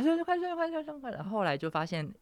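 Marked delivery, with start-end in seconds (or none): 0.0-1.2 very high-pitched repeated congratulations; crowd of well-wishers. Acted. Players flooding chat with birthday wishes, mock enthusiastic chorus. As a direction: chirp it over and over like a flood of chat messages. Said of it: "然后大家就开始".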